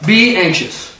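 ordinary talk

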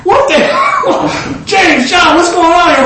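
high, acted shout of disbelief; indignant outburst. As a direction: call out as if stopped short by a commotion. Jesus halts, acting out indignant reaction.

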